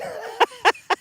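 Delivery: a sudden high-pitched laugh; squealing laugh; high burst of laughter. Real, not acted. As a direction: crack up high at the upset. Laughs at chat message.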